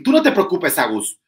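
high, loud boastful reassurance; high loud swaggering promise; mocking the cocky rival. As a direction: imitate him bragging. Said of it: "que le dijo a Agustín".

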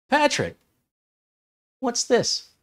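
high-pitched curious what's this; curious surprise. Retold or imitated. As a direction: sound amazed and intrigued. Asking about unlabeled box.

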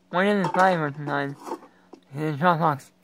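ordinary talk, nothing marked

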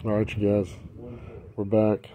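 ordinary delivery

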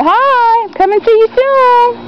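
high, lightly voiced dog speaking; voicing the dog. Owner speaking for the dog to its new mom, high. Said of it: "Tell your new mom".

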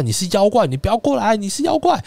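high, loud, panicky cry; boy shrieking at the rain spirit to stay back; scared shout. Retold or imitated. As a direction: yell it while backing away.

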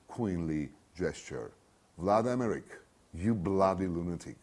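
ordinary talk, nothing marked

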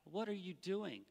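soft, mild what are you doing; a quiet, unbothered question; muted casual query; not this. Shown as a demonstration. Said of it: "So this isn't a, like".

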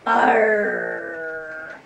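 low, loud growling arr; pirate growl. Shown as a demonstration. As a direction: growl it like an old sea dog. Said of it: "Those are good piratey exclamations".

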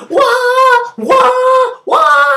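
high, repeated "wah" cries; imitated loud high shrieks; mock wailing. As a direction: wail like the girl in peril. Girl found wailing among monks.

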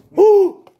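high, loud whoop from the heat; loud woo. Real, not acted. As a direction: yell it out against the burn. Burn still growing.

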